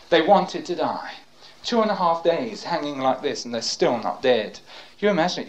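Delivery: usual speaking voice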